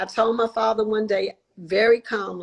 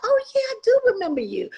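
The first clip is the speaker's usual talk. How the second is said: high, quick oh yeah of recognition; surprised recognition; 10 semitones higher, much faster than usual. Retold or imitated. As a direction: suddenly place the old friend. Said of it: "I said".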